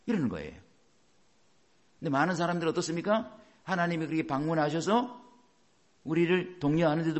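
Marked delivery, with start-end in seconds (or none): none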